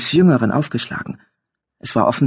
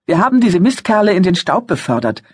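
higher, louder agitated youth; teenage boy voice; 6 semitones higher, 4 dB louder than usual. A narrator's reading. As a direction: report it like an upset teen. Older son voiced.

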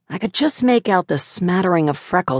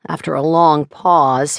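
plain reading voice

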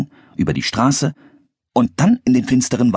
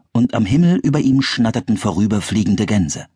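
plain reading voice

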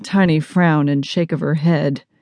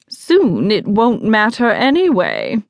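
higher, lofty and self-satisfied; haughty, more cheerful; 4 semitones higher than usual. Acted. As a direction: dismiss it airily, pitched up. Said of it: "looks a little more cheerful".

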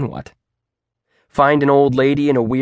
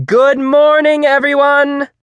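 high, loud pep-rally greeting; rousing shout; 7 dB louder than usual. A narrator's reading. Teacher rousing new students at orientation, loud and peppy. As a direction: fire up a reluctant crowd, shrill with volume. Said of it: "trying to fire us up".

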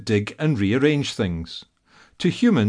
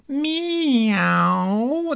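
put-on gleeful toddler shout; 13 semitones higher than usual. A narrator's reading. Toddler shouting a happy meow straight into the cat's ear. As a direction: squeal it right into his ear like a delighted toddler. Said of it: "the little girl shouted directly in his ear".

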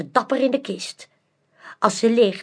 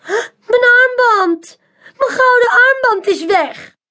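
much higher and louder, alarmed; shocked cry; 13 semitones higher, 7 dB louder than usual. A narrator's reading. Woman in audience cries out over her missing bracelet. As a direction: cry out as the loss hits.

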